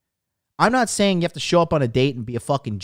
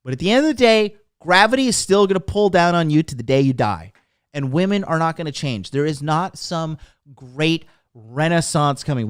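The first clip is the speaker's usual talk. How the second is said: normal talking voice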